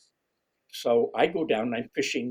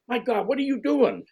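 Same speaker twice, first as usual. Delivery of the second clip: imitating an astonished angler, pitched much higher; amazed pro; 12 semitones higher, 4 dB louder than usual. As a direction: retell his shock at the catches, high and a bit loud. Retold pro amazed at catches.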